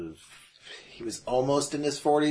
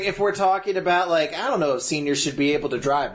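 ordinary talk, nothing marked

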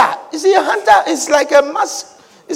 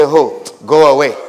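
low, offhand dismissal; dismissive brush-off; 12 semitones lower than usual. Retold or imitated. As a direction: wave them off in a deep voice. Dismissing a thief of blessings.

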